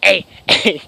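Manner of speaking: loud demonstration barks; short, sharp, repeated shouts; barked. Shown as a demonstration. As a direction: show the barking, each one punched. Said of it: "That's what I sounded like".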